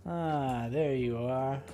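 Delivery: normal talking voice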